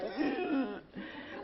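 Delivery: a sharp mock gasp with a laugh. Hearing Calvin Klein on the phone, gasping in mock shock.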